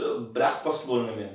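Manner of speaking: ordinary delivery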